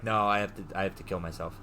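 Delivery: low, subdued, sinking to very soft; retold grim resignation. Bear alone in the bathroom, grimly resigned. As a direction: let it drop away, hopeless. Character resigned to his death.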